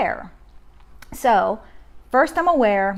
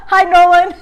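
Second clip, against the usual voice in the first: laughing greeting, much higher and louder; real laughter; 9 semitones higher, 10 dB louder than usual. Real, not acted. Mom greeting her son mid-stream, laughing. Child interrupts the live stream.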